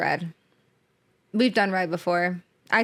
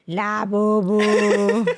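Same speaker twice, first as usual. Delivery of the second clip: imitating grandma voice; 6 dB louder, much slower than usual. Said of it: "She's like".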